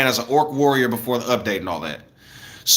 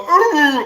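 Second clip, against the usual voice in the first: put-on high, loud muffled ooh of a toddler; toddler's muffled ooh; 12 semitones higher, 4 dB louder than usual. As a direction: mimic a baby's dull little ooh. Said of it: "My son go".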